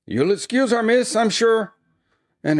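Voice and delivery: high-pitched, ingratiating character voice; smooth it over in a high put-on woman's voice; oily apologetic woman. A narrator's reading. Sister excusing the mourner to the young miss, oily and high. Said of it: "tried an oily smile".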